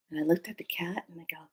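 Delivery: unvoiced hushed aside; whisper. Real, not acted. Telling her dream story, hushed whisper. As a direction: drop to a whisper.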